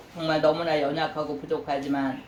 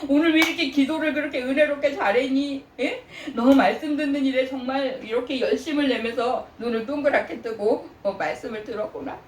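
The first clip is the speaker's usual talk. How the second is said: high, delighted praise with laughter; God praising, laughing; 6 semitones higher than usual. Retold or imitated. God applauding a believer, delighted and laughing. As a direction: voice God cheering on his child. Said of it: "하나님이 나를 막 박수 치시면서".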